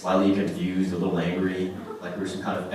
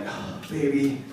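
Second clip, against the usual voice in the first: soft, pleading mock drama; hammed-up pleading lover; overdramatic boyfriend; 3 semitones higher, 4 dB quieter than usual. Boyfriend begging his girlfriend in the restaurant, softer overdramatic plea. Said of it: "completely overdramatic about it".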